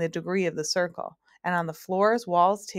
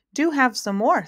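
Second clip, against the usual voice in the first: higher, louder coaxing host voice; math magician; 7 semitones higher, 6 dB louder than usual. Acted. Magician pressing more stew on guests, coaxing. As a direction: urge them to take another helping.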